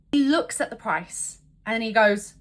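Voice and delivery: usual speaking voice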